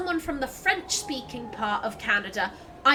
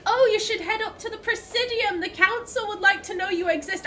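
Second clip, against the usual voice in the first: higher-pitched mock helpfulness; mocking imitation; 7 semitones higher than usual. Player parroting officer's advice to visit the Council, mocking.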